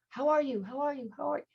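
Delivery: high, fading echo of the question; mock echo. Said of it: "It doesn't go".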